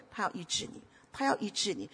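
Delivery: almost hushed, reassuring; hushed pastoral comfort; soft. Acted. Soft repeated assurance.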